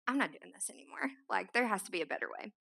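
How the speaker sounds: fed-up whisper, quick and breathless. Retold or imitated. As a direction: hiss it under your breath, done with it. Fed-up inner decision, retold.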